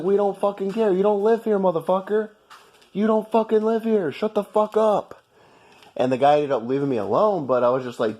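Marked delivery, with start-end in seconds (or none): 0.0-5.2 higher pitched, heated tirade; angry rant. Real, not acted. Telling off the British guy who doesn't live here, angry and heated. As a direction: let the anger rise and spit it out.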